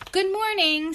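high, loud, cheery greeting; Mister Happy. A narrator's reading. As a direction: sing out a happy character's hello. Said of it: "said Mister Happy".

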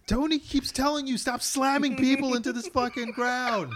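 high, loud exasperated rant; hammed-up yelling reprimand; mock scolding. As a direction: tell him off in comic outrage. Acting a scolding of Thor.

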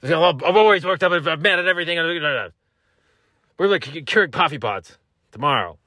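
high, loud mock-angry insistence; hammed-up always-mad ranting; worked-up voice. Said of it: "this is the way I talk now".

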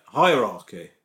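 teaching Hierarchy; correct hierarchy. Shown as a demonstration. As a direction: demonstrate Hierarchy slowly and correctly. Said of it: "You're not saying it".

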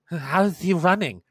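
a touch of baffled alarm; startled disbelief. Acted. Game master as an onlooker seeing the runner, startled disbelief.

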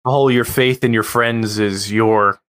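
ordinary talk

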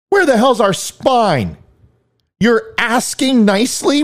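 high-pitched, exasperated demands; indignant. Real, not acted. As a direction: challenge them in outrage, pitched high. Indignant rant answering the played speech.

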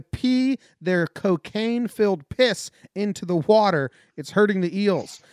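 emphatic, measured newsreader delivery; news headline read. Acted. Reads more comic news headlines.

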